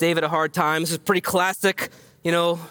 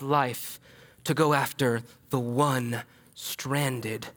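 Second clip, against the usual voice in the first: hushed reverent; 5 semitones lower, 5 dB quieter, slower than usual. Real, not acted. Quiet reverent climax.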